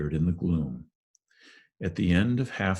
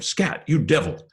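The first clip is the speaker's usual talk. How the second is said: sudden loud yell at the cats, much higher; angry shout; 10 semitones higher, 6 dB louder than usual. A narrator's reading. Neighbor yelling out the window at the cats, loud angry shout. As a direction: bark it out the window to scare off the cats. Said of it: "A cry of".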